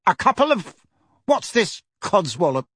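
high-pitched incredulous outburst; indignant. A narrator's reading. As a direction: splutter the words back, then dismiss them. Uncle cuts in, outraged.

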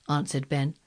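plain reading voice

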